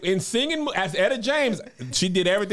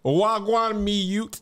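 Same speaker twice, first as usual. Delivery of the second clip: higher, slow drawn-out Jamaican patois; 5 semitones higher, much slower than usual. Retold or imitated. Quoting a celebrity's patois greeting, mock Jamaican accent. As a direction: put on a stretched-out Jamaican accent. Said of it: "[name]' patois is top tier".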